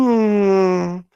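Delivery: loud, high-pitched drawn-out "mm"; imitated sinking-realization hum; long humming noise, held high; high sustained hum. Imitates man's reaction on realizing.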